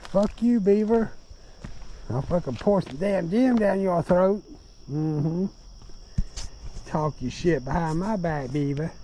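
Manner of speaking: cuss out the beaver like a rival. Real, not acted. Drunk man cussing out a beaver, high-pitched mock taunts.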